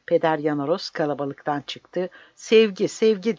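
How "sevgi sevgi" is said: higher, louder plea; crying out. Acted. Priest crying out love to the soldiers, loud plea. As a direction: call out the word to the crowd. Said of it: "diye bağırdı".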